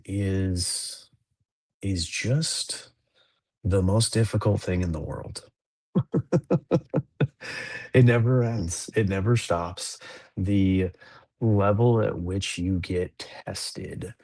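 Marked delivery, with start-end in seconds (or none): 5.9-7.9 high, rapid chuckling; rueful laugh. Real, not acted. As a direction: laugh high at how hard it is. Laughs about parenting being hard.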